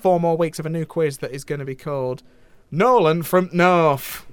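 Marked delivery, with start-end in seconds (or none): 2.7-4.2 slow, loud game-show reveal of the title; drawn-out showman announcement; big announcement. Acted. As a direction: announce it like a quiz host. Host announces new quiz segment.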